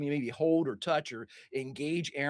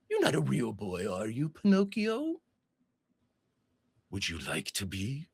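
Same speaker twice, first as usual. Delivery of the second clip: Pennywise; 5 semitones lower than usual. Acted. Pennywise dismissing George as a little toy, low taunt. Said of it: "turns to you and says".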